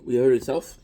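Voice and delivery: loud, rising "hurt". Real, not acted. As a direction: blurt it out in disbelief. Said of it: "I don't know how".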